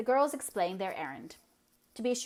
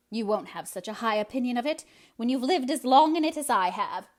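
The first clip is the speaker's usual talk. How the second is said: louder, sour put-down; sour retort; 4 semitones higher, 9 dB louder than usual. Acted. Eliza sourly retorting to young Anne's optimism. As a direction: snap back sourly. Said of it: "retorted Miss Eliza sourly".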